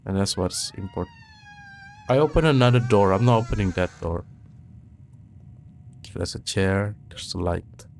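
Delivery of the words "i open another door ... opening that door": high, loud and fast in panic; scared horror game streamer. Real, not acted. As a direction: blurt it out, spooked. Backs away from scary door.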